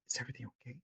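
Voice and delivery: hushed near-whisper, very soft; really quiet. Retold or imitated. Manager to a yelling customer, to de-escalate, very quietly. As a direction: drop right down to a murmur. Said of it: "I just say it really quiet".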